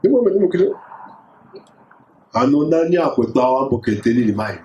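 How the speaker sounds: ordinary delivery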